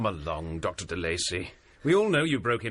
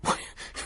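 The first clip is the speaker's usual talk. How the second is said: scoffing laugh. Acted. Scoffing at the devil claim.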